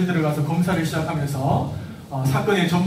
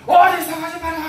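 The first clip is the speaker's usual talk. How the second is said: higher, louder sharp yell; loud scolding; 10 semitones higher, 5 dB louder than usual. Shown as a demonstration. Parent yelling at child who lied, loud scolding. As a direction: startle the child with a shout. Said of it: "이렇게".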